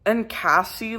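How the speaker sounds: ordinary talk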